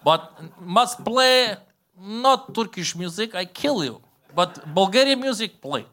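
high, drawn-out mock threat in accented English; slow, emphatic foreign craftsman laying down terms; Bulgarian bagpipe maker. Bagpipe maker warns customer which music to play, hammed-up accent.

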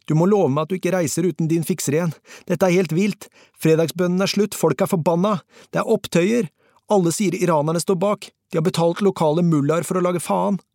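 high-pitched, agitated torrent of words; fast, nearly incoherent. A narrator's reading. Fixer phones Peter from the riot, rapid and almost incoherent. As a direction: pour out the alarming news in a high rush. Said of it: "Han snakket fort og nesten usammenhengende".

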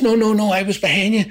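high loud protesting mate; defensive Ronnie. Retold or imitated. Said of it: "He says".

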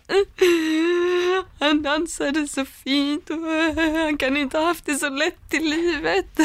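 imitating her own crying over the dancer; retold crying. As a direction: sob it out like she did on the sofa. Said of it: "Och jag bara".

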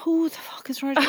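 whispered who the fuck is Rodrigo; incredulous whisper. Real, not acted. Host disbelieves her friend's wrong answer, whispered. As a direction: hiss it under your breath in disbelief.

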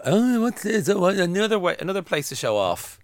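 high ditzy gushing voice; TikTok airhead. Retold or imitated. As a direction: gush like a shallow show-off. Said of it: "these TikTok idiots will wander into it".

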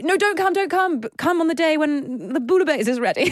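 high-pitched protest; retold plea, pitched well up; mock pleading. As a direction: put off a guest in a high, pleading voice. Retelling pleading with an unwanted guest.

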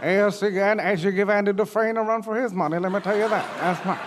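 a hint of Morgan Freeman. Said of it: "Morgan Freeman, [name], when you're ready".

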